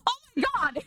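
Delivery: very high startled shriek into laughter; shocked squeal and laugh. Real, not acted. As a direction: shriek at the surprise. Surprise finding hamster behind fridge.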